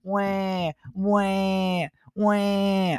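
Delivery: high fake baby wails; mock crying. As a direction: whine like a crybaby. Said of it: "there's gonna be Fred Hool crying".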